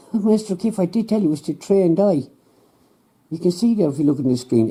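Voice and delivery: lightly voiced know-it-all scientist; smug scientist. Scientist, laugh, higher.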